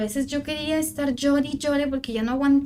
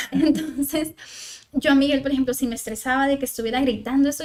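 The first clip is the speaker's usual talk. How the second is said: ordinary talk, nothing marked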